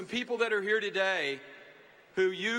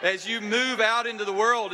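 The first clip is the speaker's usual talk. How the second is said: "move" punched louder, falling tune after; stress on move; 2 semitones higher, 5 dB louder than usual. Shown as a demonstration. Families stress to graduates moving out, emphasis on "move". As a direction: hit "move" hard, as if you mean it. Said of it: "they emphasize the word move".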